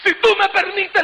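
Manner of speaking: high, crying plea to God; retold sobbing prayer; tearful pleading. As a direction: beg through tears, voice pitched high. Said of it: "Mi llanto era continuo".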